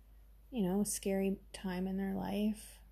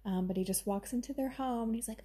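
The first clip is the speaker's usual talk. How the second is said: normal talking voice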